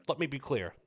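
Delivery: a hint of a measured presidential speech; lightly voiced politician at a podium; host tries a quick president voice, barely shifted; Obama impression. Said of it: "that's my best Obama impression".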